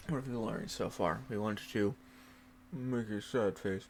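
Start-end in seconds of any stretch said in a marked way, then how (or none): none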